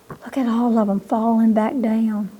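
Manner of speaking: ordinary delivery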